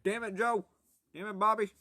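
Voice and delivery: high cartoon dad impression; King of the Hill. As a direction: scold like an exasperated cartoon father. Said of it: "King of the Hill, damn it, Bobby guy".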